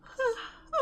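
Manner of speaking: long high yawn; yawning sigh. Real, not acted. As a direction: let a big yawn out. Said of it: "that's enough yawning".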